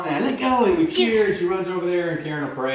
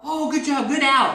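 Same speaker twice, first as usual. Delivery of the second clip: high bright praise; excited praise; 11 semitones higher, 5 dB louder than usual. Shown as a demonstration. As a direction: pop up with praise. Praising dog letting go.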